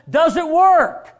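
rising to a loud shout; preaching shout. Real, not acted. Preacher shouts down doubters of the Bible. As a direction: hammer the words home, loud.

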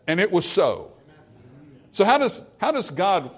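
usual speaking voice

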